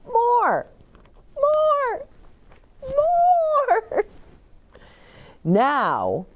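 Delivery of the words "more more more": put-on high, stretched child's more; high delighted toddler pleading; child demanding. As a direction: squeal for a tighter hug. Perry begging squeezes.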